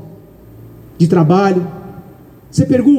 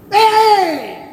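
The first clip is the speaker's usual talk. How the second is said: mock shout; 9 semitones higher than usual. Caballero gritando en la calle.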